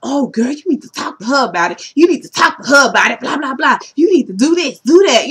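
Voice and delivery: mock goading, quickening and rising; egging her on. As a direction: imitate pushy friends stirring her up. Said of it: "Tammy and Shawnee egging her on".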